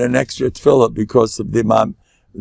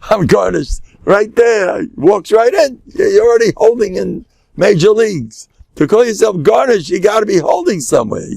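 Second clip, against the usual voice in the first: high, animated scoffing; hammed-up incredulity, pitched well up; mock amazement; 8 semitones higher, 3 dB louder than usual. Mocking the beginner's claim.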